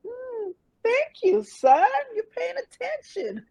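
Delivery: high, slow sassy thanks to son; high teasing mother to son; sassy pleased. Retold or imitated. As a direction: preen at the compliment. Replying to son's compliment.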